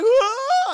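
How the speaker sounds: high, loud scream; mock child scream. Twins scream at dad's threat, mock shriek. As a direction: shriek like startled kids.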